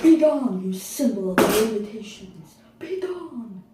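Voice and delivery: loud, grandiose proclamation; hammed-up commanding shout; theatrical banishing cry. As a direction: cast it out like a stage sorcerer. Skit dramatically banishing the wheelchair.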